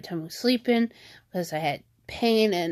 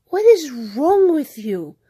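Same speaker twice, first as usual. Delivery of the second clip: drawn-out, higher, indignant demand; retold outburst, slow and incredulous; exasperated scolding; 4 semitones higher, 4 dB louder than usual. Sister retelling her rebuke to brother, slow exasperated scolding.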